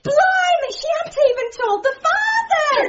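high-pitched, incredulous cry; shocked outburst. Acted. Co-worker realises the father has not been told, shocked outburst. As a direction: blurt it out, scandalised.

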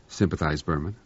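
ordinary delivery

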